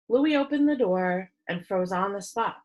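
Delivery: plain reading voice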